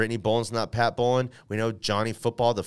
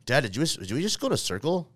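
put-on higher-pitched questioning child; lightly mocked child's voice, pitched up; imitating kids; 5 semitones higher than usual. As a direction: mimic puzzled kids piping up at Dad. Acting out kids questioning dad.

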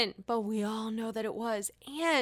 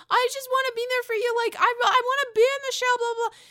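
much higher, fast, louder squeaky imitation; mock Sonja; 12 semitones higher, 5 dB louder, faster than usual. Recapper mimics Sonja gushing to Luann, high and fast. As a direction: rattle it off high and quick like a gushing friend.

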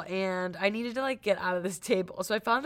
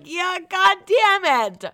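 exasperated cry; 11 semitones higher, 10 dB louder, slower than usual. Retold or imitated. Retold frustrated reaction.

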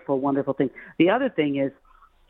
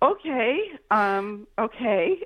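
high, hesitant questioning okay; mock unsure, with halting rises in pitch; uncertain new parent; 7 semitones higher, much slower than usual. New mother looking at her husband, acted uncertainty. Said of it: "you look at your husband".

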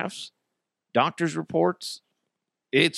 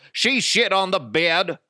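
ordinary delivery

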